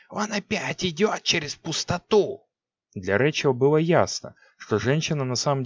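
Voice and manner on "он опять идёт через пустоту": high, slow hissing witch voice; eerie sorceress voice; hissing Six. A narrator's reading. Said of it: "прошипела Сикс".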